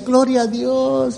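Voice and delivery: soft, slow and half-hearted "Gloria a Dios"; mock a tepid believer's praise; lukewarm praise. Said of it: "Bien tibios para decir". ("Gloria a Dios" suave, lento y sin ganas)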